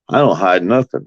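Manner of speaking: ordinary talk, nothing marked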